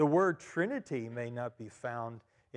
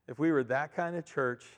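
ordinary talk, nothing marked

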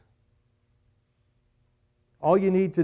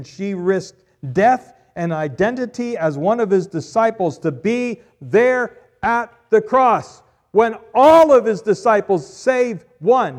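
higher, loud, slowing preacher's peak; preaching at full force; 7 semitones higher, 11 dB louder than usual. Acted. Preacher to congregation about Mary's courage, loud peak. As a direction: climb to the cross with full force. Preacher describes Mary at the cross.

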